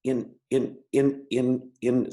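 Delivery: stammering on repeated words; stuttering. Real, not acted. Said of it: "so I'm a person who stutters".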